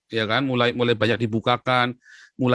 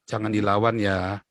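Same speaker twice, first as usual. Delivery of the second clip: low, slow, gentle caution; calm a child down slowly and softly; father's calm advice; 3 semitones lower, 4 dB quieter than usual. Retold or imitated. Father telling his bullied son not to fight, calm and low.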